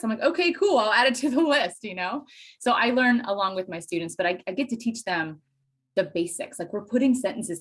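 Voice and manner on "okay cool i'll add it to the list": high, louder cheerful okay; cheerful reply. Retold or imitated. Teacher answering student's dialect note: high cheerful. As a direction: brightly accept the new word.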